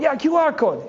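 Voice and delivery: mock high-pitched Egyptian valet, excited; high eager valet. A hint of a valet pitching his idea to the doctor, eager and high. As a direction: lift the voice way up, eager and quick.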